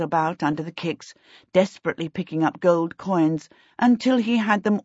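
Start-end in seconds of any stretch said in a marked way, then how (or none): none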